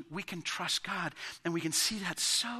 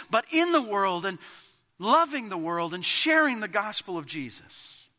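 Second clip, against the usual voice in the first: louder, higher, slowing on key phrases; rally the church to mission; preaching at full force; 5 semitones higher, 6 dB louder than usual. Acted.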